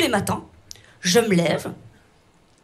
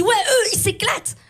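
impressed reaction; 6 semitones higher than usual. Acted. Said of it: "T'entends leur nom, tu dis".

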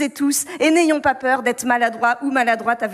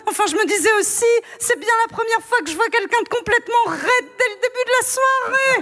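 put-on high giggly drunk guest chattering on; high, laughing, clueless party voice; tipsy Catherine; 7 semitones higher than usual. Drunk Catherine at the wrong party, high and giggling. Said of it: "Je me mets en mode Catherine Fro".

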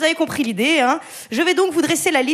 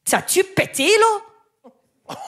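put-on broad Québécois accent, rising high at the end; hammed-up Québécois twang on the one phrase she knows; 8 semitones higher, much slower than usual. Radio chronicler shows off her new Québécois accent. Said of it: "Je vous la refais".

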